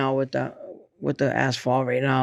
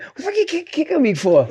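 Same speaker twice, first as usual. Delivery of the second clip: indignant retort; 14 semitones higher than usual. Retold or imitated. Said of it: "I'm like".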